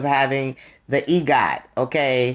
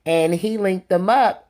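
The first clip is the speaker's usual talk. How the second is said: ordinary talk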